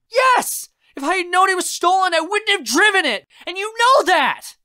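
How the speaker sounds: very high, loud, fast outburst; furious yelling. Acted. Raz defends driving stolen car, furious yelling. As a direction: scream it back in fury. Raz yells at doubting uncle.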